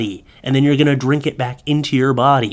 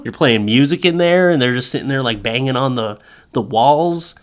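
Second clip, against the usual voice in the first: ordinary talk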